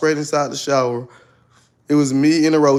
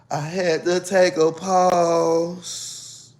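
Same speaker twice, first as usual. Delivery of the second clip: very slow; 3 semitones higher, 3 dB quieter, much slower than usual. Real, not acted. Dramatic pause.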